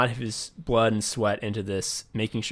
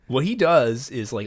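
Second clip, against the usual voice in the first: usual speaking voice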